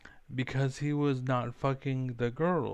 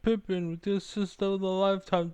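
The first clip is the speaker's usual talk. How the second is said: mock play-by-play; 7 semitones higher than usual. Streamer calling his teammate's play like a sports announcer, higher and hyped. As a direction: call the assist like a TV sportscaster.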